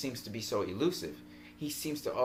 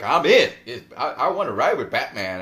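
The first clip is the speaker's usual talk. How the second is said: louder, eager mock enthusiasm; eager quote; 9 dB louder than usual. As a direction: play the thrilled rookie lightly. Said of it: "So he's like".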